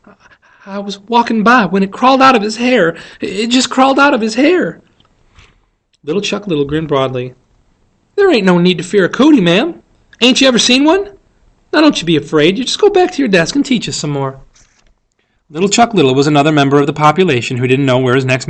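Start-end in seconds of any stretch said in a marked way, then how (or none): 0.0-4.8 high-pitched, distressed explanation; desperate. A narrator's reading. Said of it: "Miss Caroline said desperately". 8.1-14.4 put-on higher, louder country boy; Little Chuck, cheerfully reassuring.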